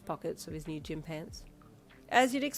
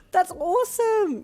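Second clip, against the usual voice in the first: much higher, loud, slow squeal; 13 semitones higher, 11 dB louder, slower than usual. A narrator's reading. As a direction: squeal with joy. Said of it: "you squealed".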